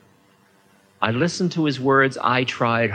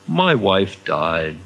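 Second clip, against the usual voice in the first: put-on slow grieving drunk; 5 semitones lower, much slower than usual. A narrator's reading. Drunk confesses his loss.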